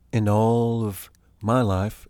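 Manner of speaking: ordinary talk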